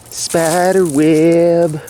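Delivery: very slow, high creeped-out drawl. Real, not acted. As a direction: stretch it out, spooked. Repeats spider web drawn out.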